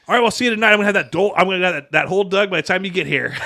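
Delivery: playing the dad, already itching to dig; eager dad. Son imitating his eager dad on the phone, loud and keen. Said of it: "just, like, a little bit of a".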